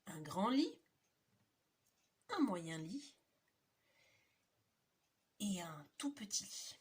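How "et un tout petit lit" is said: little whispered aside; tiny whisper. A narrator's reading. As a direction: whisper the tiny bed softly.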